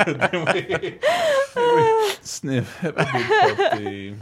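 the song line broken by laughter; laughing through it. Real, not acted. Repeating his favorite song line, laughing. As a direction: giggle through the party line. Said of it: "I've been doing that for twenty years".

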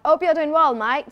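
Cockney accent. Shown as a demonstration. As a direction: greet her in cheeky Cockney. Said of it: "I use my Cockney accent".

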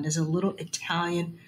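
normal talking voice

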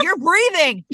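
high, loud insistence; mock life-saver's urgent shout; emphatic mock command. Acting out a forceful rescue.

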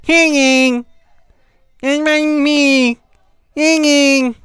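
high, loud mocking intro voice; man doing a woman's voice, mocking; wife imitating him. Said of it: "an impression of my wife doing an impression of me doing the intro".

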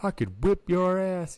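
angry dad. Retold or imitated. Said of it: "he says".

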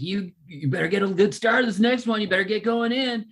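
high, loud mock heckle at the outfielder; jeering fan yelling at a player; heckling from the stands. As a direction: taunt him from left field. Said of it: "and I was like".

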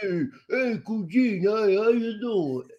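slow, hammed-up Italian-American greeting; lazy mock Jersey Italian accent. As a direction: greet your cugine like a movie wiseguy. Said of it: "Can you talk like that".

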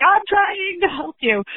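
high, slow, exasperated plea; high-pitched strained retort; exasperated high squeak. Retold or imitated. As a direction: squeak it out in frustration. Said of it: "And I'm like".

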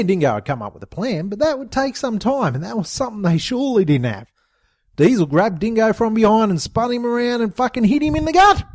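ordinary delivery, nothing marked

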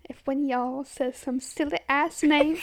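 slow, loud mock threat; comedy skit impression. Cohost quoting a favourite skit, slow mock-stern impression. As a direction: imitate a skit character laying down a warning. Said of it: "that skit is so funny".

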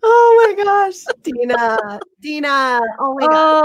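high, slow, breaking with emotion; choking up over the kindness; tearful. Real, not acted. Host touched by sweet comments, tearful high cry. Moved by viewers' kind words.

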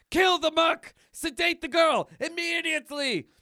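high, loud comic-book command; acted urgent shout; shouted order. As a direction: yell it like an alarmed henchman. Villain's panicked order in comic.

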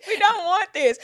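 high, loud, laughing tease; mock disgust at a mushy dad; loud playful ribbing; teasing daddy. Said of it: "I'm like".

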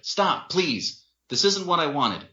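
high, upset begging; shouted plea. A narrator's reading. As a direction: beg them to stop fighting. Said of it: "Winston shouted".

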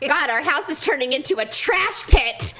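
hammed-up household complaint; going off. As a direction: imitate a husband ranting in disgust. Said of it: "immediately he starts going off".

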